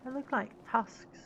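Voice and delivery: ordinary delivery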